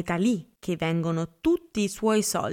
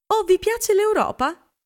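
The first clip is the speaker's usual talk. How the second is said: much higher, louder delighted gasp; light up with sudden surprise; surprised exclaim; 13 semitones higher, 5 dB louder than usual. A narrator's reading. Catherine delighted someone mentions Europe, surprised and high. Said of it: "esclamò lei sorpresa".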